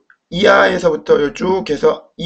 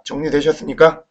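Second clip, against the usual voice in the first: ordinary delivery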